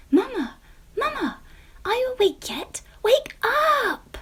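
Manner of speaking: put-on high, loud, bouncing child's call; excited shout. A narrator's reading. Little pumpkin shouting to wake her mamma, high and loud. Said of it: "shouted a bouncy Jemima".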